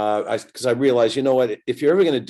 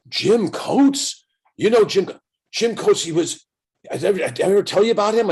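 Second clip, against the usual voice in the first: excited recall; 9 semitones higher than usual. Retold or imitated. Kubek excitedly recalls old teammate.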